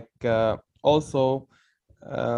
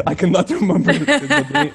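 laughing; 12 semitones higher, 6 dB louder than usual. Real, not acted. Laughs while forgetting the name.

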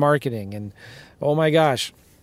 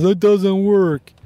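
slow, whiny mock of a doubter; 5 semitones higher, 5 dB louder, much slower than usual. Mocking the viewer who quits, slow whiny doubter voice. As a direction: mimic the complainer who gives up. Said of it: "Don't be that guy that says".

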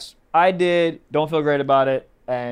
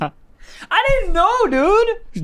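high-pitched, drawn-out protest; loud protest; 5 dB louder, much slower than usual. Real, not acted. Co-host teased about a married woman, loud defensive protest. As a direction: plead innocence loudly, stretching the words. Defending himself against the teasing.

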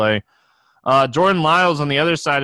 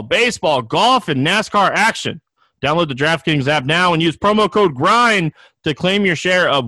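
upbeat ad read, pitched up; booming prize announcer; 4 semitones higher than usual. Acted. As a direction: sell it like a radio spot. Reads a sponsor ad.

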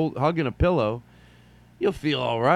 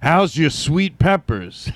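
slow, louder sleazy come-on; mock smooth pickup line; 4 semitones higher, 5 dB louder, slower than usual. Old man boasting how he charmed women. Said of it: "beautiful women and go".

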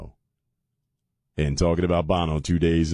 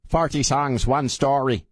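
higher-pitched, hammed-up Irish accent; bad Irish accent; 6 semitones higher, slower than usual. Host reading the memoir's subtitle, bad Irish accent. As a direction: put on a terrible Irish lilt. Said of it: "It's the worst Irish accent".

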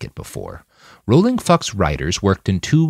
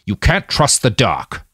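slow, higher-pitched insistence; insistent; 4 semitones higher, 4 dB louder than usual. A narrator's reading. Old man warns the others about the dark, insistent. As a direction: press the warning slowly. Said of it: "Donald Ferris insisted".

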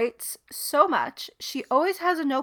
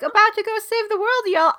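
Southern Bubbles voice; 10 semitones higher, 7 dB louder than usual. Retold or imitated. Talking toy's Southern catchphrase, high Southern accent. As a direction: chirp it like a cowboy-hat toy with a Southern accent. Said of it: "Southern Bubbles".

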